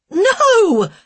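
high, loud anguished no; cry of refusal. A narrator's reading. Wife refusing the baby, loud anguished cry. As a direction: cry it out in protest. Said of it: "Elaine cried".

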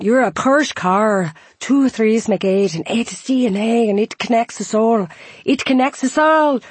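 high, loud sing-song Scots; Scottish accent. Retold or imitated. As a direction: roll the R's, thick Scottish. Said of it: "his R's rolling in that Scottish accent".

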